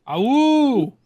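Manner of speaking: high, loud playful wolf howl. Pretend. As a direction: throw your head back and howl like a wolf. Team name Wolfpack, then howls.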